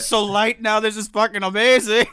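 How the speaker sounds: high, loud delighted voice; hammed-up elation, laugh breaking in; overjoyed sheep. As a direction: imitate a giddy animal freed of its wool. Voicing overjoyed shorn sheep.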